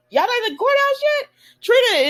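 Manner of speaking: high disbelieving question; surprised. Retold or imitated. Curtis surprised they're still here.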